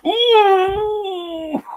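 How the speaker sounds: long, high-pitched "ooh" wail; high imitated wail of pain; mock pained howl. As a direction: howl out a drawn-out, high "ooh" as if just hit hard. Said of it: "he just goes".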